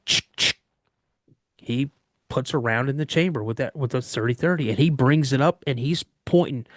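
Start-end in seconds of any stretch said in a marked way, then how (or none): 0.1-0.6 crisp voiceless double click; imitated rifle bolt. Dad chambering a rifle round, imitated clicking noise. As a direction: make the rifle-chambering noise with your mouth. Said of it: "I hear my dad go".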